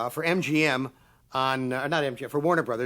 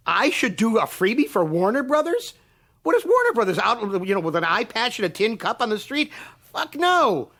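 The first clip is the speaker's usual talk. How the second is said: outraged; 7 semitones higher than usual. Real, not acted. Ranting about studios wanting free work.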